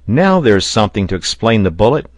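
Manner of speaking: loud, emphatic discovery; excited exclamation. A narrator's reading. Pencroft on seeing the cloth Top brought, excited exclamation. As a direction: call it out loudly to the group. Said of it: "exclaimed Pencroft".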